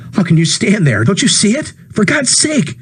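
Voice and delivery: a man losing his grip, pleading; panicked outburst. Acted. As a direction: plead with the crowd in rising panic. Ed's panicked outburst at crowd.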